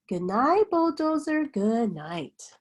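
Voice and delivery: plain reading voice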